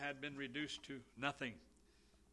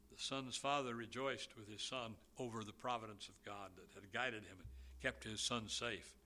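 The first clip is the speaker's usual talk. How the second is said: normal talking voice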